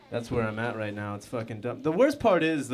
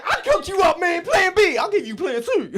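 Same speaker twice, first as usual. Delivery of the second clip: very high, loud frantic hype; hammed-up over-excited clerk; super-pumped clerk; 9 dB louder than usual. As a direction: yell it at the top of your pitch. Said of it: "He was super pumped to give me Plan B".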